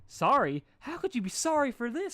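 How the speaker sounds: high, loud incredulous joy; overjoyed disbelief. A narrator's reading. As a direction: squeal in happy disbelief. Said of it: "her heart leaping in utter happiness".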